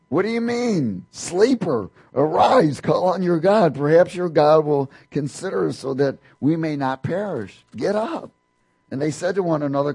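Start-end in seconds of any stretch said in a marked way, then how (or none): 0.1-8.3 raised, higher captain's cry to wake Jonah; urgent captain. Acted. Ship captain shaking sleeping Jonah awake, loud and urgent. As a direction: shout him awake from his sleep.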